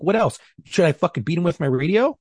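high-pitched heated rant; retold angry rant. Cop yelling back at supervisors about options, heated and high. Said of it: "And I was getting angry".

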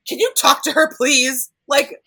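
high, loud mock blaming; exasperated parent. Parents arguing over who turned the girl, imitated as loud exasperation. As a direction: act out an indignant quarrel, pushing the accusation.

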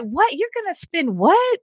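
high, slow disbelief; incredulous. Retold or imitated. She hears a student is there for a husband, high and slow. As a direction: stretch out the shocked what. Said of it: "I'm like".

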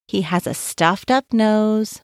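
plain reading voice

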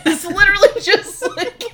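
high, loud laughing talk. Real, not acted. Podcast host cracking up over the ending's long looks, laughing. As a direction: crack up while getting the words out.

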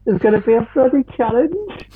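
very high put-on lady voice; Factual Fanny. Host announces the box questions as a high-pitched alter ego. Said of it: "I'm gonna stop doing that".

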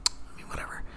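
raspy whispered shrug; hoarse, breathy dismissal. Real, not acted.

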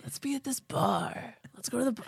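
whispery creepy come-on. Retold or imitated. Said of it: "creepy and being like".

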